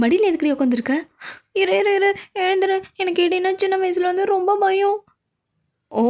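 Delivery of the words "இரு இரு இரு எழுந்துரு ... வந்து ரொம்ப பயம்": terrified of the thunder, begging; frightened cry. Acted. இடிக்கு பயந்து அலறுதல். (இடிக்கு பயந்து உயர்ந்த குரலில் பதறுதல்)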